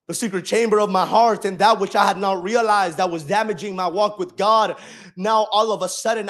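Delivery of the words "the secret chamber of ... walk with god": forceful preaching. Acted. Preaching testimony at full force.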